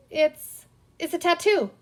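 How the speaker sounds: high, loud blurted stammer; a boy caught out, blurting an excuse. A narrator's reading. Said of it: "he blurted".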